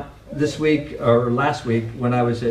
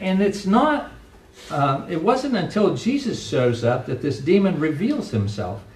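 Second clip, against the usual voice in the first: ordinary talk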